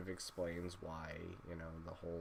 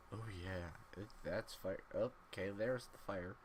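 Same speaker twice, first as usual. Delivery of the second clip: ordinary talk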